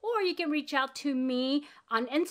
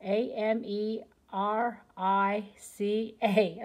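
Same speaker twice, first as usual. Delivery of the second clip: spelling it out; much slower than usual. Shown as a demonstration. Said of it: "Let me make sure I spelled that right".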